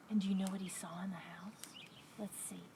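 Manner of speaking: soft, quick near-whisper; hushed suspense. Acted. Storyteller asks listeners what donkey saw, whispering. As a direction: drop to a secretive whisper.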